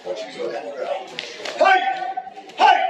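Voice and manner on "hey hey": sharp loud martial arts yell; ki shout. Shown as a demonstration. Instructor demonstrating a defensive ki shout to class. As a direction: explode with a short battle cry. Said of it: "I haven't heard of Ki all day".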